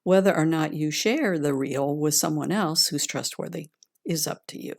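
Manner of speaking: calm grounding exercise. Acted. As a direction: gently guide them inward, unhurried. Leads a heart meditation.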